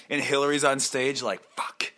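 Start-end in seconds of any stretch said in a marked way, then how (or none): 1.6-1.9 hushed mock swear; whispered curse. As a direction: breathe it under the breath. [name] on stage, quiet curse.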